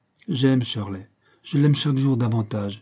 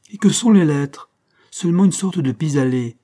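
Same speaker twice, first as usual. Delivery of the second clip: higher and louder, dismissive; scornful protest; 4 semitones higher, 5 dB louder than usual. Acted. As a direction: wave away the letters with scorn. Shirley protests Caroline's leaving.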